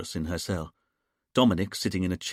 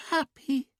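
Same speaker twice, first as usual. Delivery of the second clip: put-on much higher, slow sing-song child voice; childlike sing-song. A narrator's reading. Julia in trance answering the hypnotist, childlike sing-song. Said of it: "sing-song voice, almost like a child's".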